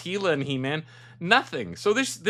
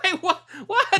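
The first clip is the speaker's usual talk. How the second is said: shocked disbelief; 14 semitones higher than usual. Retold or imitated. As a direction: cry it out in sudden disbelief. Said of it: "You're going".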